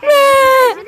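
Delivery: loud shouted correction; shouting. Real, not acted. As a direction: yell the fact over them.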